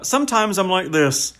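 imitated West Country country guy; West Country bumpkin. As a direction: play a daft West Country farmhand. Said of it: "So he's kinda talking like this".

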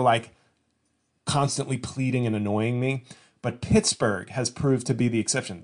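normal talking voice

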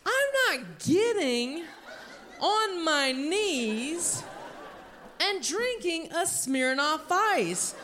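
high, slow, emphatic protest; indignant refusal. Acted. Comedian refusing the crowd's game, indignant.

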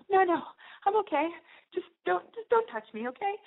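high, strained mock protest; high-pitched, upset brush-off; flustered refusal. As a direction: act out fending off someone's touch. Retold squirming away, upset.

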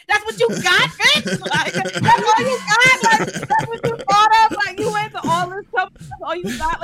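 high, loud, scornful ridicule; mocking taunt. Real, not acted. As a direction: laugh at a weak threat. Taunting the voicemail caller.

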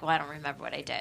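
fast, low mumbled excuse; quick quote. Retold or imitated. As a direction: rattle it off, low and sheepish. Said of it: "And you're like".

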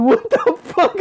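high, shocked squeal; startled shriek. Retold or imitated. Seeing a big beetle on his shoulder, a high startled squeal. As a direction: yelp it as the bug is spotted.

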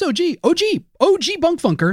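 playful rap hype; hyped. Acted. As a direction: hype it like a rapper.

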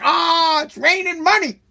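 high, giddy cheer with a whoop; hammed-up giddy voter; mock celebrating. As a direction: play the gullest fan of free money. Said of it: "You're like".

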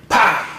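sharp, high mock gunshot; imitated gunshot. Retelling the shot that rang out in the dark, imitated gunshot. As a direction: spit out a quick bang.

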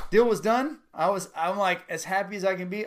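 high and loud, bubbling over; happy excitement. Real, not acted. Telling the cohost the sale closed, voice lifted with glee. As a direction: let the joy spill out. Recalling quick house sale joy.

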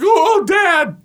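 high, loud mock cry of a startled child; imitated startled yell. Parent imitating the oldest child in a loud arena, startled yell. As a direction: yelp it like a startled kid. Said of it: "time to scream at the top".

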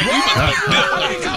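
high, loud, laughing boast; laughing shout. Retold or imitated. Bragging about holding their liquor.

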